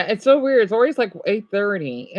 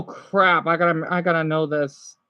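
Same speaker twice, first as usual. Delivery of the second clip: ordinary talk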